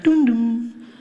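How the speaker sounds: sing-song two-note chime; chime imitation. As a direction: imitate the airport chime with your voice. Mimicking airport chime sound.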